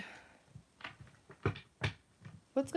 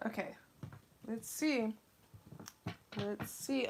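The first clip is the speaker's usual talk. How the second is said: ordinary talk, nothing marked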